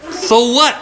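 put-on high, cheeky sneer of a rude girl; rude girl retort. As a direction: toss it back like a bratty kid.

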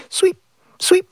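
high, fading swish of a broom; sweeping sound. Retold or imitated.

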